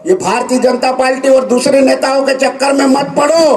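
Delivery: normal talking voice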